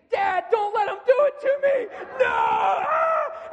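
high, full-out panicked scream; screaming child. Retold or imitated. Son screams to his dad at the blood draw, screaming. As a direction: imitate a terrified boy screaming for dad. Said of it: "and he is screaming".

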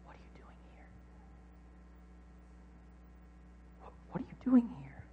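breathy unvoiced whisper of God; God's gentle whisper. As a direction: whisper it like a still small voice. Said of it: "He speaks to him in a whisper".

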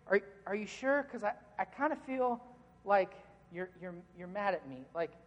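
soft, halting, careful questioning; tentative checking. Acted. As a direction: feel it out gently, stumbling over the start. Acting out a worried, tentative partner.

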